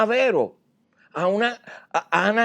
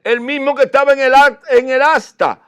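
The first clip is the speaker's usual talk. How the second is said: fiery preaching; 7 semitones higher, 6 dB louder than usual. Acted. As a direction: preach it out with full conviction. Preacher rises to full force. (predicación con fuerza y voz alzada)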